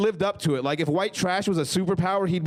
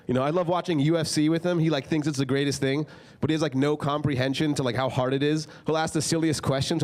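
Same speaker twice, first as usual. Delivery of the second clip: ordinary talk, nothing marked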